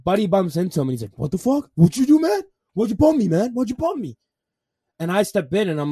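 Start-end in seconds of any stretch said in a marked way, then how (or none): 1.2-4.2 higher-pitched, indignant mock challenge; angry confrontation. Short guy confronting the man who bumped him, mock angry. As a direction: imitate an aggressive drunk picking a fight. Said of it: "Like, just, like, angry".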